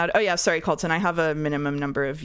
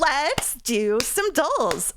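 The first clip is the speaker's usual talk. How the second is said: very slow, higher, stretched out; drawn out; 8 semitones higher, much slower than usual. Shown as a demonstration. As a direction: stretch every word of "let's do some dolls" slowly. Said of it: "I'm never doing that again".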